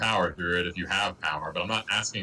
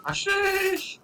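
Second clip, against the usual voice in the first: calling out. Acted. Player calls out to NPC.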